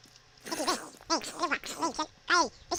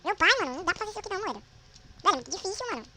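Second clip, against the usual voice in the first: louder, higher ranting; heated complaint; 6 semitones higher, 7 dB louder, much faster than usual. Real, not acted. Gamer rants about the impossible jump. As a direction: vent it in a rush.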